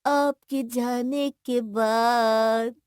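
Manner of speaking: very slow, words stretched out; choking up. Acted. Brother facing sister's departure, slowly trailing into silence. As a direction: drag it out, unable to go on. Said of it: "आगे कुछ नहीं बोल पाया".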